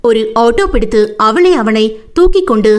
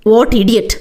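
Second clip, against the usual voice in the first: plain reading voice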